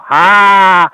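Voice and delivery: one long, loud "ha"; big, drawn-out one-burst cackle; signature comic laugh. Shown as a demonstration. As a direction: belt out a single held comedy laugh. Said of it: "that was always my laugh".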